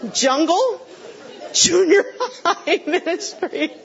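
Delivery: high, incredulous echo breaking into laughter; imitating friends' disbelief, laughing on the second question; mock incredulity. Youth worker friends react to her career, mock incredulous. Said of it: "And they said".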